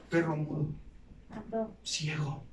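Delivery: a low, soft, grave rebuke from God; a hint of a solemn divine judge; voice of the Lord rebuking. The Lord condemning a faithless servant, low and soft.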